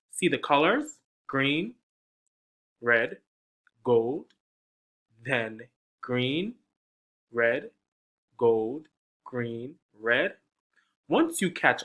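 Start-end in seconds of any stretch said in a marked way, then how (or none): 0.1-10.3 bright sing-song list of colours; reading to children. Acted. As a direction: lead the little ones through the colours.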